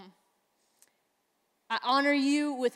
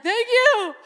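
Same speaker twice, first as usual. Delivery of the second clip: much higher and louder sobbing thanks; blurting thanks while breaking down in tears; crying thank you; 10 semitones higher, 8 dB louder than usual. Retold or imitated. Meeting her spiritual hero, she sobs thanks high and loud. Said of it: "started to break down crying".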